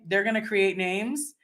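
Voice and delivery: ordinary talk, nothing marked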